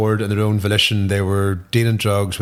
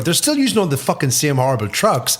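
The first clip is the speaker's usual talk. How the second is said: ordinary talk, nothing marked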